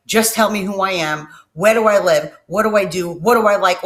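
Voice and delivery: put-on loud, frantic pleading to girlfriend; panicked questions. Calling his girlfriend, loud and frantic. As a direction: demand answers in a panic.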